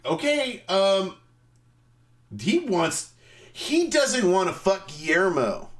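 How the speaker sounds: high, flustered reading aloud; stunned staff. Retold or imitated. Staff reading the manuscript, stunned and high-pitched. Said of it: "They're like".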